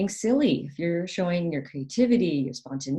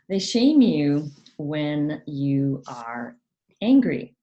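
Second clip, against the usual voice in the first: ordinary talk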